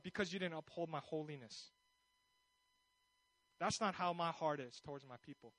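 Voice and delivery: low, soft, grave rebuke; solemn God. Acted. God telling Moses he misrepresented His heart, solemn rebuke.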